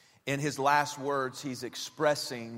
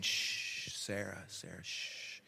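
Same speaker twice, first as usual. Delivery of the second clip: put-on soft, whispered shush to a screaming toddler; calm shushing; 7 semitones lower, 10 dB quieter, slower than usual. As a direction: hush the child gently, nearly silent. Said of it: "you know, being calm, just saying".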